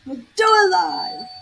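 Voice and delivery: hammed-up newsman catchphrase; mock anchor outburst. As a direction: belt it like the angry anchor again. Repeating the anchor's famous line.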